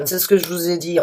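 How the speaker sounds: usual speaking voice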